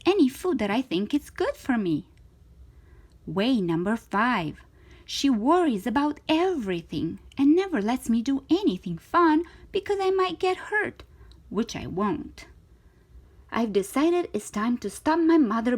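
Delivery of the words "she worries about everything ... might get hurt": ordinary delivery, nothing marked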